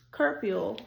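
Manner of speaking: ordinary delivery, nothing marked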